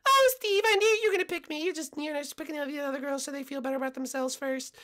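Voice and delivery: high falsetto, self-assured; confident contestant. Acted. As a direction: gush in a put-on girl voice.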